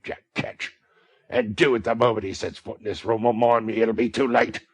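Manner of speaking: loud menacing threats; exasperated threat. A narrator's reading. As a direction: threaten her with venom. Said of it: "exasperated beyond all bounds".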